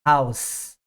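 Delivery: House ending in a voiceless S; unvoiced final s. Shown as a demonstration. Teacher demonstrating singular house, voiceless S. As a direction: close House on a hissed S, no buzz. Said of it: "Casa a mesma coisa".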